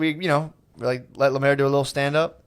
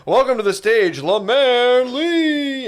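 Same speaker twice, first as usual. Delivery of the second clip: high, stretched-out name like an MC; stage announcer; 8 semitones higher, much slower than usual. Retold or imitated. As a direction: announce the act, dragging out the name. Acts out host announcing a comic.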